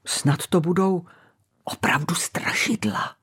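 scared breathy whisper; frightened whisper. Acted. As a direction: whisper it in fear of ghosts. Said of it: "zašeptal polekaný Kaštánek". (polekaný šepot)

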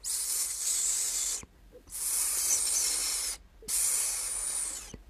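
long, soft voiceless hissing; quiet snake hiss. A narrator's reading. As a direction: hiss softly into a sleeper's ear. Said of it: "který mu tiše šeptal do ucha". (tiché hadí syčení)

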